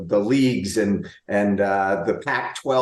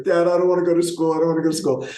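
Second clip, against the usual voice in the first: higher, fast whiny mock sob of a tired boy; imitating crying kid; 7 semitones higher, much faster than usual. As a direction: play the son whimpering in bed. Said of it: "and he's just crying".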